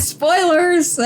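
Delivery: high, laughing cry of "Spoilers"; a high, teasing, laughing "Spoilers". Real, not acted. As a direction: call "Spoilers" out through laughter. Laughing warning.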